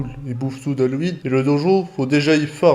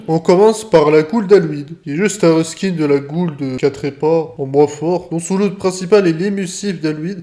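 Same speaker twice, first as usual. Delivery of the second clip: ordinary talk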